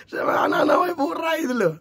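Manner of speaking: put-on slipping into a quick Kupang street-kid accent, half laughing. Shown as a demonstration. Preacher joking he now talks like Kupang kids, quick Kupang street accent. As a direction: catch yourself sounding like the local kids, amused. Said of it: "kok beta omong sudah mulai ke anak-anak".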